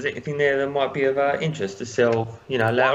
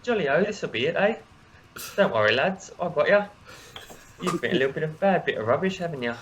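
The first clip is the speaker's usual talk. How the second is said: put-on cheery English accent, jolly and cheeky; jolly English halfling in an English accent; 2 semitones higher than usual. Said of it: "for inspiration for that accent".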